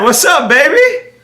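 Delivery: high, drawn-out rising hello; slow, teasing high lilt; playful greeting. Real, not acted. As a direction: croon it upward to a missed friend. Playful greeting to awaited friend.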